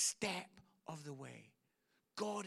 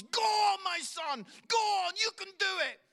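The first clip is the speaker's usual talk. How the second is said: much higher, very loud yell of encouragement; cheering shout; 13 semitones higher, 12 dB louder than usual. Retold or imitated. Father cheering his cycling son on, full shout. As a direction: bellow like a dad at a race. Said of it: "He goes".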